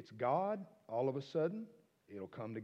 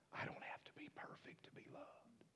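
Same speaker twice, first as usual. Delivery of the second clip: soft whispered realisation; tender whisper; 10 semitones higher, 15 dB quieter than usual. Real, not acted. Preacher whispering what healed him, tender. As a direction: breathe it out as a discovery.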